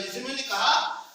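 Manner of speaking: ordinary talk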